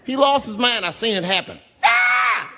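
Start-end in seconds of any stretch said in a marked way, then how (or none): 1.8-2.5 very high scream; mock warrior scream; battle scream. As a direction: let out a wild charge yell. Man snaps and screams.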